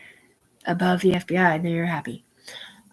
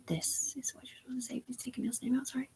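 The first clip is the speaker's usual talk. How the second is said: hushed aside, almost no voice; whisper; 5 semitones higher, 16 dB quieter than usual. Real, not acted. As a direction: whisper the instructions under your breath. Aside coaching what to say.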